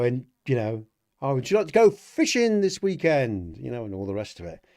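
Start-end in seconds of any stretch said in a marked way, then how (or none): 1.2-3.6 loud, high, chirpy fake-friendly dad greeting; mocking a fake-nice father, loud and high; mock cheerful dad. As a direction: ham up a sugary father voice. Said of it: "Here's my impression of life at Bry's house".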